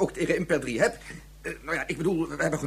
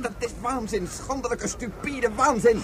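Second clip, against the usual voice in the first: outrage; 6 semitones higher, slower than usual. Acted. Outraged at police violence.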